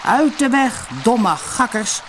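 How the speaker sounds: high, loud angry yell; furious shout. A narrator's reading. Helmer yelling at the curious ducks, furious. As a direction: scream it in fury. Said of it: "schreeuwde hij woedend".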